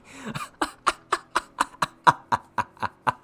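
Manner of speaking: long high staccato cackle; drawn-out insane ha-ha-ha; mad taunting laugh. A narrator's reading. Villain mocking a foe who cannot kill him, cocky insane laughter.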